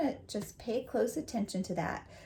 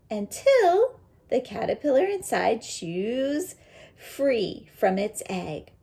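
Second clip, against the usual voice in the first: louder, expressive storybook read; reading to children; 2 semitones higher, 6 dB louder than usual. Acted. Teacher reading the butterfly book aloud to her students. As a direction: read it out big for the class.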